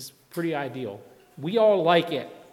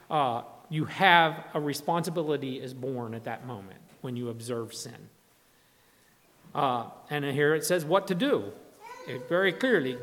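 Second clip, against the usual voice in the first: usual speaking voice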